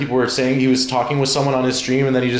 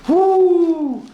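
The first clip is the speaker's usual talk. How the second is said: high, loud whoop; real excited whoop; 4 dB louder than usual. Real, not acted. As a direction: shout woo for joy. Hyped about German food.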